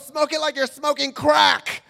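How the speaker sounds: high, slow, shouted order; exasperated yell. Retold or imitated. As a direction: yell instructions as the exasperated woman. Woman yells instructions, acted.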